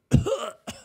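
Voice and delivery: put-on choking cough; mock cough. Kid in a house full of hairspray, mock coughing. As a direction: cough as if on toxic fumes.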